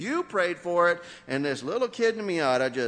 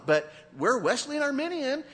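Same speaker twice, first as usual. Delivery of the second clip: ordinary talk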